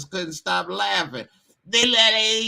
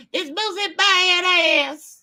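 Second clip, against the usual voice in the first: much higher, slow squeaky mock [name]; high-pitched [name]; 4 dB louder, slower than usual. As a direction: push the voice way up like [name]. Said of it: "his voice be getting all high pitched".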